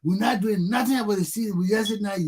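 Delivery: ordinary delivery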